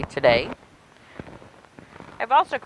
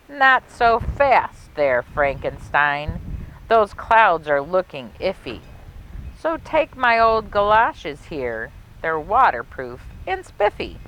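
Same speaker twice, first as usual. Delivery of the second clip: put-on lilting rhymed read-aloud for children; storybook narration; 3 dB louder, slower than usual. A narrator's reading. Librarian reading a Halloween rhyme to kids, storybook lilt. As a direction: savor each rhyme like a spooky bedtime tale.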